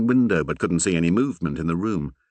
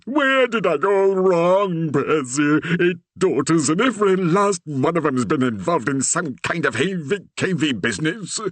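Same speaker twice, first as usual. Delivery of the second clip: despairing Reverend; 10 semitones higher, 3 dB louder than usual. A narrator's reading. The Reverend lamenting to Percy about his daughters, despairing. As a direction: wail over his wayward daughters.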